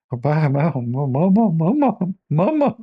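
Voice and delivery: put-on high whiny childish wail for mama; mock a scared boy calling for his mother; hammed-up panicked child calling mama; crying for mom. Mocking scared man crying for mom.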